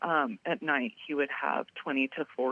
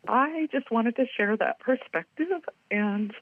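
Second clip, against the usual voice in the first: choking up, voice pushed higher; teary; 5 semitones higher than usual. Real, not acted. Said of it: "I'm sorry, I get teary".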